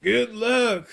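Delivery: put-on high-pitched old teacher calling out; mild yell. A narrator's reading. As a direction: call it after someone leaving, voice up high. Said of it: "I'm pretty sure he yelled".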